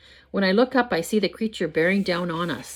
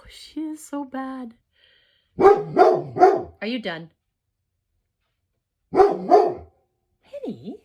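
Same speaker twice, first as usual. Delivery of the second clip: high, drawn-out scolding of the dog; fed up with the dog, stretch it out high; exasperated scolding; 9 semitones higher, 6 dB louder, much slower than usual. Real, not acted. Owner scolding her dog Penny, high and exasperated.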